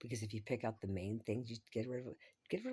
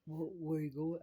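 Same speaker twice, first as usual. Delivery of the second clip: high, loud stammering question; imitating him; 6 semitones higher, 5 dB louder than usual. Voicing the host asking where she is going, flustered. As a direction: play him caught off guard.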